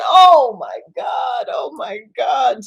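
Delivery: loud, anguished wail, slowed down; patient hears her husband died, wailing in grief; crying out in grief. Acted. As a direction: cry out as the news hits. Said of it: "She starts crying for Bob".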